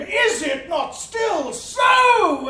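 slow, loud, high challenge; shouted challenge. Retold or imitated. [name] retelling his challenge to a drunk in the inn, shouting slowly. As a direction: throw the question back with a shout.

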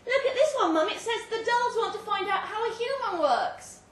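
put-on high, loud, eager child; a small girl excitedly calling her mum; little girl's voice. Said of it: "a little girl's voice say".

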